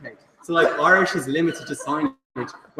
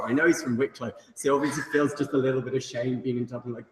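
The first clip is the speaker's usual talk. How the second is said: usual speaking voice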